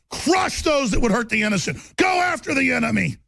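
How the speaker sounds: high, shouted commands; fierce proclamation in his spirit's voice; spirit's battle cry. Real, not acted. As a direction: roar it like a crusader. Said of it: "my human spirit saying".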